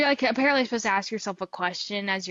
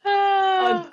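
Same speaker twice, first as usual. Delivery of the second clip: shrieking laugh; 10 semitones higher, 5 dB louder than usual. Real, not acted. Reacting to dirty joke remark.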